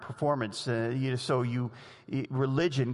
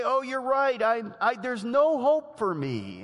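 high-pitched, hopeless plea, voiced lightly; despairing quote; 10 semitones higher, 4 dB louder than usual. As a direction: sound like someone who gives up. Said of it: "Too much despair, you say".

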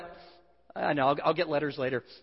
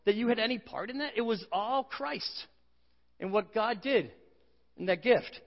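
Paul rebuking; 5 semitones higher than usual. Retold or imitated. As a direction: scold them like they can't be serious. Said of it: "He says".

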